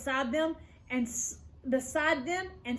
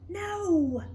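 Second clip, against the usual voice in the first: plain reading voice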